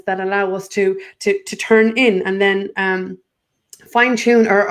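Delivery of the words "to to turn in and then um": normal talking voice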